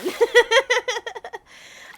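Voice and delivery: a bright loud laugh. Real, not acted. Laughing after greeting.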